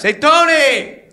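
high, loud call across the room; busy gatekeeper yelling for Tony. Retold or imitated. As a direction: shout over to a coworker. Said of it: "He's like".

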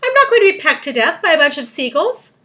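high, loud indignant refusal; crying out. A narrator's reading. Earthworm dreading the seagulls, crying out. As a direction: keep the outburst going. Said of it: "cried the earthworm".

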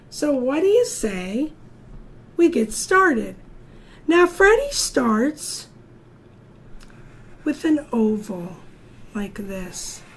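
ordinary delivery

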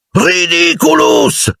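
high, long angry cry; angrily cried spell. A narrator's reading. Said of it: "gridò Ron con rabbia". (incantesimo gridato con rabbia)

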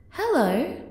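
breathless awestruck "Hello?"; a starstruck "Hello?" to a long-lost love. Retold or imitated. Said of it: "Just a starstruck".

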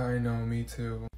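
usual speaking voice

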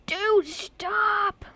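high, drawn-out plea to stop; playful high-pitched exasperation; exasperated protest. Real, not acted. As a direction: whine it at someone who keeps pestering you. Telling a game character to stop.

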